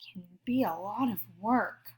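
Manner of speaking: half-whispered, as if sharing a secret; whispered confiding aside. Real, not acted. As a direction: drop to a whisper to admit it's hard. Aside about how hard apologies are.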